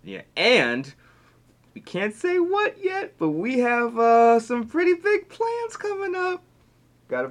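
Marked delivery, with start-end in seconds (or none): 1.7-6.4 high, slow teasing voice; teasing excitement. Real, not acted. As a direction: draw out the secret, grinning. Teasing a secret project.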